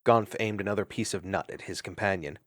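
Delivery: plain reading voice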